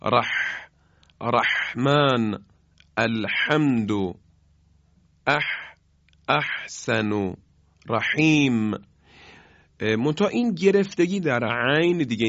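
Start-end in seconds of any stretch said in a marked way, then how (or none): 0.0-8.7 ح in رحمن and احسن rasped with a tight throat; tight, breathy throat ح; constricted throat ha. Shown as a demonstration. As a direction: squeeze the throat on each ح.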